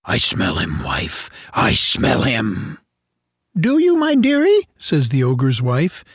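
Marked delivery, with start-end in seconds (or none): 0.0-2.8 low, slow ogre sniffing; slow and low, savour the scent. Acted. The ogre telling his wife he smells Jack, low and slow. 3.5-4.7 much higher sweet wife voice; ogre's wife. Acted. The ogre's wife answering her husband, high and sweet.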